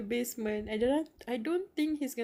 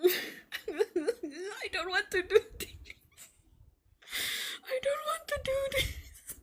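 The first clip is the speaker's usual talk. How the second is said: high, slow crying plea; tearful dread; 13 semitones higher, slower than usual. Real, not acted. Streamer dreading the next chapter, high crying plea. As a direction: plead through tears, dreading what comes. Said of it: "why am I crying".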